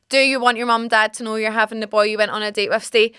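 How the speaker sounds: ordinary talk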